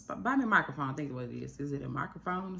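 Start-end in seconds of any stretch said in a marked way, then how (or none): 0.0-0.9 half-joking call-out, pitched up and louder; loud playful demand. Real, not acted. Vlogger calls on her critic to buy her a microphone, loud and half-joking. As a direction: tease the critic into buying you a mic.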